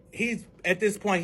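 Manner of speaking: normal talking voice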